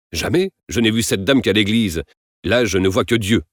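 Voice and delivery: plain reading voice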